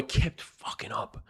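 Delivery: under-the-breath whisper; whispered aside. Real, not acted. Talking about the opposing star, a whispered aside. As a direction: mutter it to yourself in a whisper.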